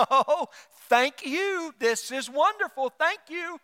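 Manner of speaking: high, slow, gushing mock thanks; fake gratitude. Receiving an unwanted gift from a hint-dropper, fake delight. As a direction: overplay a polite thank-you for an unwanted gift. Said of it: "and you go".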